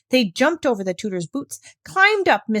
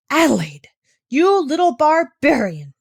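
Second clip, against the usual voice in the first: very angry; 6 semitones higher, 6 dB louder than usual. A narrator's reading. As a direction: tell the child off furiously. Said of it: "she was very angry".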